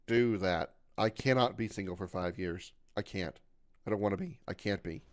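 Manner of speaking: usual speaking voice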